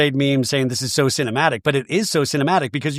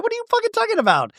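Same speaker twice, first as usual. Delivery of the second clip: much higher, fast, incredulous; mock outraged squeal; much faster than usual. Brittany snapping at the captain, a fast high squeal. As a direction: fire it off in shrill disbelief.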